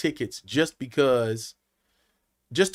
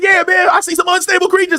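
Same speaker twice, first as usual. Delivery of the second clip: stage shout; 8 dB louder than usual. Retold or imitated. Punchline shouted to the crowd.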